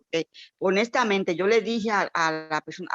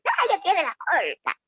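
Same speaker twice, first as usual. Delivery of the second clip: higher, sneering accusing voice; mock accusation; 9 semitones higher than usual. Imitating church gossips blaming the girl, sneering. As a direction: parody the gossips' finger-pointing.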